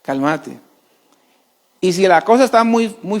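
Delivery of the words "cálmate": low, soft "Cálmate"; quiet, calming correction; calm, soothing. Pretend. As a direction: settle her gently.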